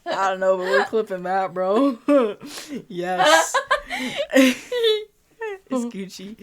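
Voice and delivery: cracking up, loud; laughing through the words. Real, not acted. Both cracking up.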